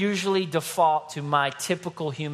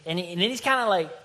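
ordinary talk, nothing marked

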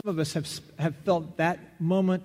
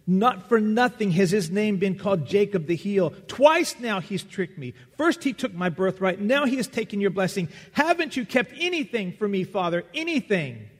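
loud, higher pleading complaint; bitter lament; 6 semitones higher, 5 dB louder than usual. Acted. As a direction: pour out the hurt of being cheated twice, loud and high. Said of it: "sobbed violently and most bitterly".

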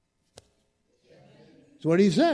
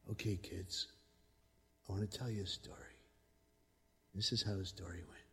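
much softer, lower hush; quiet storyteller; 9 semitones lower, 18 dB quieter than usual. Retold or imitated. Second leader hushing a gym of kids, low and soft. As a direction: draw them in by going soft. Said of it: "they got quieter".

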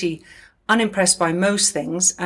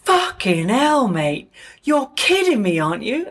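[name], gobsmacked; 5 semitones higher than usual. Retold or imitated. Noel gasping at the landlord's Ferrari, high and amazed. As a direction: imitate a rock star stunned at a car window. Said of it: "looks in through the window at Gordon and goes".

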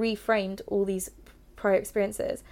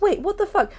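much higher and louder, jolted awake; sudden alarmed realisation; 8 semitones higher, 5 dB louder than usual. Retold or imitated. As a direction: snap out of it in disbelief. Sudden realisation, inner outrage.

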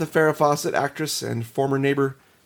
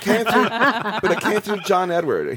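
laughing; 6 semitones higher than usual. Real, not acted. Laughing through the quote.

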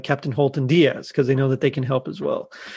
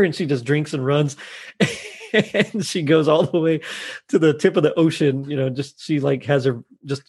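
ordinary talk

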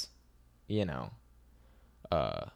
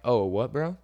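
friendly, easygoing, a bit louder and higher; nice about it; 5 semitones higher, 5 dB louder than usual. Shown as a demonstration. To someone rude in public, friendly and unbothered. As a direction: disarm him with a casual bro. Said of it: "You're kinda nice about it".

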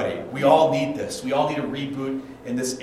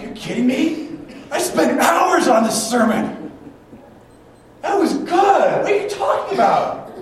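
high, loud indignant outburst; hammed-up offended yelling, stretched words; mad; 14 semitones higher, 9 dB louder than usual. Pastor protesting an elder's critique of his sermon, mad. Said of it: "And I was mad".

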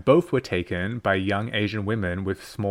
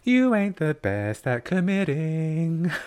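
higher, slow, lilting jibe; sing-song; 6 semitones higher, slower than usual. Acted. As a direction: sing the criticism out slowly. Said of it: "in a sing-song voice".

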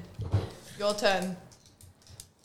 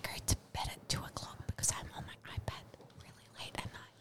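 barely audible ASMR whisper; 12 semitones lower, 11 dB quieter than usual. Shown as a demonstration. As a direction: whisper it very softly into the mic. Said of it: "You gotta whisper it into the microphone".

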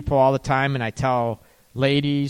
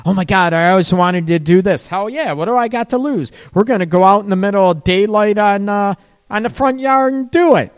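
loud, high, thrilled daring; excited; 6 semitones higher, 6 dB louder, faster than usual. Pretend. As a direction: shout it like a wild dare. Voicing daring older couple.

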